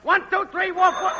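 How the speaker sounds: high-pitched mic-check holler; woofing and yelling. Acted. Technician testing the network for Max, woofing and yelling. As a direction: yell out a test call to Max. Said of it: "woofing and yelling".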